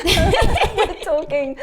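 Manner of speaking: real laughter spilling over the words; laughing. Real, not acted. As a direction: crack up as you repeat it. Bursts out laughing mid-story.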